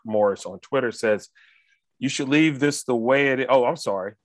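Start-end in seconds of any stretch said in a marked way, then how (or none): none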